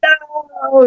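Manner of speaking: very high, hammed-up heartbroken cry; mock anguished wail. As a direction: wail like your hero just stabbed your heart. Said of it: "I was like".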